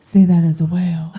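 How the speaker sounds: loud mock sultry command; sexy voice. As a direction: boom it out as a hammed-up seductress. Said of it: "In your sexy voice".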